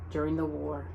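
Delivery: usual speaking voice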